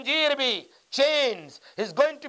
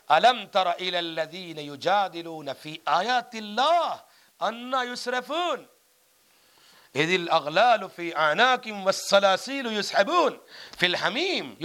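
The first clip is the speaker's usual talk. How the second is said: Quranic recitation; 5 semitones lower than usual. Acted. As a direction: intone the verse like a reciter before the congregation. Recites Quran verses in sermon. (قرآن کی ترتیل کے انداز میں تلاوت)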